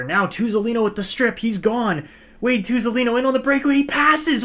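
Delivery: fan-commentator losing it on a breakaway; excited call. Real, not acted. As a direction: ride the breakaway up to a peak.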